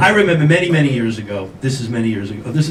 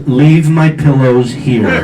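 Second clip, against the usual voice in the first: slow, lower and louder command; stern order; 4 semitones lower, 5 dB louder, much slower than usual. Retold or imitated. Angry girlfriend to the man leaving, stern order. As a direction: order it firmly, word by word. Angry lover throws him out.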